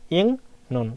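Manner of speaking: "ইং নুন" as ing, then nun, no k; ng sound. Shown as a demonstration. Teacher shows student the nasal rule, ng sound. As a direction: say "ইং নুন" with the first syllable ending in ng. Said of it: "অনসারের মতো উচ্চারণ হয়".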